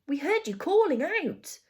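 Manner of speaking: put-on high, loud young girl's exclamation; high-pitched, urgent outburst; excited cry. A narrator's reading. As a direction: cry it out in alarm and relief. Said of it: "cried Judy".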